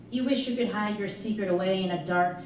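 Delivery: plain reading voice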